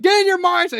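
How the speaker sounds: high, loud, mock coach voice; pep-talk coach. [name] coaching Melia to focus, hammed-up pep talk. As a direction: push her like a coach.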